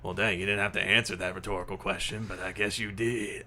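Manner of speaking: lightly put-on voice, brisk patter; wry ghost sidekick. Ghost Marcus teases the detective, wry. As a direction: needle a friend as a dead companion.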